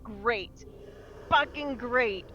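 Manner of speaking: normal talking voice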